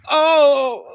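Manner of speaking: loud, high imitated cry; Jim Carrey. Imitating the apartment door scene from Dumb and Dumber, loud cry. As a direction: belt out a big comic "oh". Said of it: "Jim Carrey slams the apartment door".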